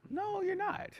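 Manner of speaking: high, soft imitation of the fiance; fiance's voice. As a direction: play the fiance gently telling him to calm down. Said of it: "She's like".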